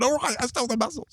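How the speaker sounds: mock confession. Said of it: "owned up and gone".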